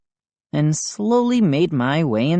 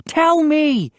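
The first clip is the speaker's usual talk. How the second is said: insistent, higher; repeated demand; 12 semitones higher, 3 dB louder than usual. A narrator's reading. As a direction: press him again. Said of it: "he repeated".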